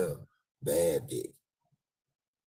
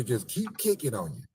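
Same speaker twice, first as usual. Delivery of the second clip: ordinary talk, nothing marked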